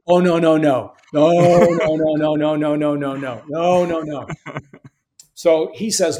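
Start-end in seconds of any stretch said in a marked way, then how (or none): 0.1-4.3 emphatic mock refusal. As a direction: act out a wife flatly refusing to go. Wife refuses the move to Maine.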